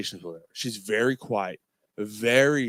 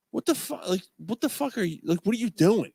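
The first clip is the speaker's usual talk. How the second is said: stunned; 7 semitones higher than usual. Retold or imitated. Speaker stunned at the stranger.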